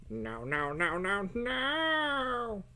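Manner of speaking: nasal no's climbing steeply in pitch; nasally voice. Pretend. Artist goofing around with repeated no's, nasally. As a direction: rise to a long, high nasal final no. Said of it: "That's my nasally voice".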